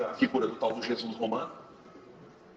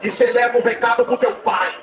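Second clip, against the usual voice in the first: loud, high Pentecostal testimony climax; thundering church preacher relaying a prophetic message; full-force preaching; 8 semitones higher, 9 dB louder than usual. Acted. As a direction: preach it out at full voice, driving each phrase home.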